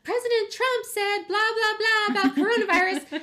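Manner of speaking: high, mock-breathless mom reporting news; imitating mom's voicemail. Daughter mimics her mom's news voicemail, high mock voice. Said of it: "calling and leaving a voicemail and be like".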